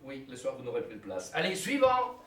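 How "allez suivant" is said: calling out. Acted. Clerk calling next client.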